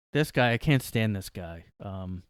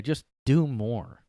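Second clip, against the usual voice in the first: slow, sarcastic "Just do more"; mocking slow delivery; 2 semitones higher, slower than usual. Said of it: "one of those phrases everybody says now".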